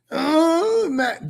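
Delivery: high, begging mock voice; mock desperate. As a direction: parody her sounding desperate. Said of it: "It sounds desperate".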